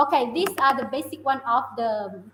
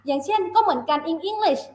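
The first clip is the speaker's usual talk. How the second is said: normal talking voice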